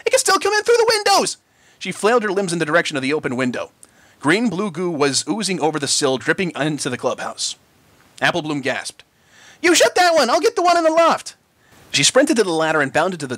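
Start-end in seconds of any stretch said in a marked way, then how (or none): 0.0-1.4 high loud frantic shout; frantic filly warning; panicked yell. A narrator's reading. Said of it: "yelled Sweetie Belle". 9.6-11.4 urgent orders. A narrator's reading. Filly giving urgent orders.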